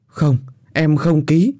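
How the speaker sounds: high, slow, defiant through tears; desperate refusal. Acted. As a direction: refuse him, voice breaking. (vợ khóc lóc từ chối chồng)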